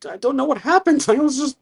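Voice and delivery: high-pitched, mock-tearful young man; acted distressed stammer, pitched well up; crying son. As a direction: plead it out high, as if near tears. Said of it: "And he's crying".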